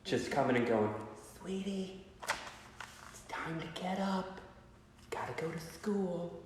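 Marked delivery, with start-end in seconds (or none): 1.4-6.4 soft, high, tender mom voice; gentle mom wake-up. Retold or imitated. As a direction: coax a sleeping kid awake gently. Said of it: "wakes you up like my mom used to".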